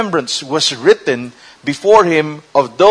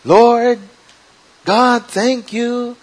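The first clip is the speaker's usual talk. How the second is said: slow, higher-pitched pious prayer; holy, reverent prayer; 6 semitones higher, much slower than usual. Acted. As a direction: act out a reverent thank-you to God. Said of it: "we're very holy and reverent".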